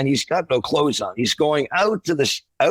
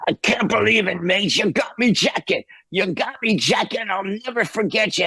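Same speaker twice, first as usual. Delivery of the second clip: high, grateful Cockney gush; 8 semitones higher than usual. Retold or imitated. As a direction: gush thanks like an emotional drunk rocker. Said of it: "I'm not doing it that great".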